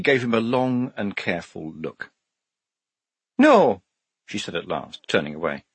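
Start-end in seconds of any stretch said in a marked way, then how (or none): 3.4-3.8 high, louder character voice. A narrator's reading. Shufti rejecting the man shown to her, high and emphatic. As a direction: refuse in a high-pitched voice.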